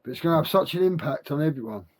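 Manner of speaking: toothless speech; talking with no teeth in; without dentures. Real, not acted. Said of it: "I never put my teeth in".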